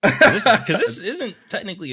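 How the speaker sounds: high and loud, laughing through the words. Real, not acted. Friend laughs while correcting himself about the genre. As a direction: blurt it out while cracking up.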